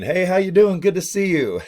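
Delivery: high, bright, put-on friendly hello; fake cheerful greeting. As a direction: greet them with a forced smile.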